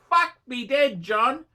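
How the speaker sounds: usual speaking voice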